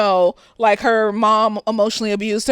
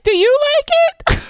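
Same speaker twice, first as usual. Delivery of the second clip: much higher, sugary dodge of the question; mock-innocent question; 13 semitones higher, 4 dB louder, faster than usual. Answering a friend about an ugly outfit, high sugary dodge. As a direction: chirp it back sweetly to avoid answering. Her fake answer to loved ones.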